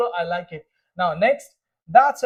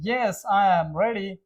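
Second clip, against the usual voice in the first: slow model answer; much slower than usual. Shown as a demonstration. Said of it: "Are you ready?".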